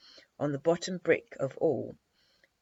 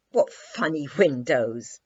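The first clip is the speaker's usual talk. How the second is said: exclaimed; 8 dB louder than usual. A narrator's reading. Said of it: "she exclaimed".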